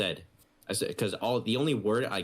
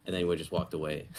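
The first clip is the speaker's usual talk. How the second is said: normal talking voice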